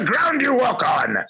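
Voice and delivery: high-pitched, hammed-up loathing; mock contempt. Co-host pretending to despise listeners, hammed-up contempt. Joke tirade at YouTube listeners, then retracted.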